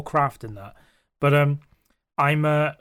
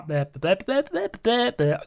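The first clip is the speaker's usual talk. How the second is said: tongue-tied babble; 5 semitones higher than usual. Real, not acted. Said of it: "I've been forgetting how to talk".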